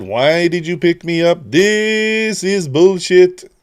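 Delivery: voicing the cat. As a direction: drag it out like an indignant cat. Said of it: "He said".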